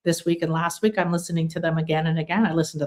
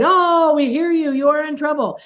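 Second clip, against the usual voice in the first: mock universe voice; 7 semitones higher, 4 dB louder than usual. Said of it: "the universe doesn't hear it".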